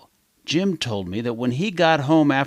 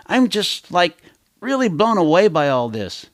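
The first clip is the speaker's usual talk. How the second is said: higher, excited fan voice; breathless admiration, pitched up; gushing teenage girl; 4 semitones higher, 3 dB louder than usual. Acted. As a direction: gush like a starstruck teen. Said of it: "One girl told a newspaper reporter how much she admired".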